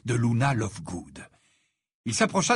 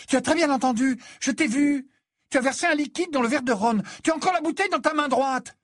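put-on high, insistent, indignant girl's voice; rising, urgent scolding; indignant accusation; 13 semitones higher, 4 dB louder than usual. A narrator's reading. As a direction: press the accusation hard, pitched high. Hermione accuses Harry of spiking drink.

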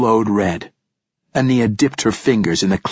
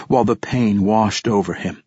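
ordinary delivery, nothing marked

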